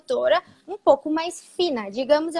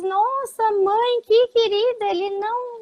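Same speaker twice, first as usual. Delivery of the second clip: cooing admirer; 7 semitones higher, 3 dB louder than usual. Retold or imitated. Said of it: "todo mundo diz".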